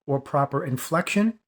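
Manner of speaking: normal talking voice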